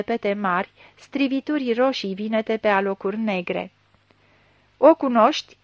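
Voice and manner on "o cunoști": louder, harsh demand; snapped question. A narrator's reading. Chief questions blind suspect over the body, snapping. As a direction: snap it at the suspect. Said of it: "se răsti șeful".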